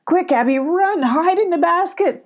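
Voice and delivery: high, loud command; urgent call. Acted. Molly calling Abby to hide in the basket. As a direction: shout her friend to safety. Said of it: "Molly called".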